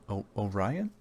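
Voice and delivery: puzzled crowd. Acted. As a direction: stammer the name in doubt. Crowd confused at the name.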